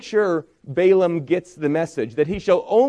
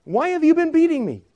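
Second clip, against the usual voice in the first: much higher voice for the talking donkey; 12 semitones higher than usual. Acted. Preacher voicing the beaten donkey asking Balaam why, much higher. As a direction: pitch way up to play the donkey. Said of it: "the donkey says".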